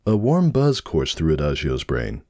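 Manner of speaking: usual speaking voice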